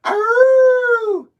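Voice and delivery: high drawn-out "arroo" howl; imitated wolf howl. Imitates wolf howling at moon.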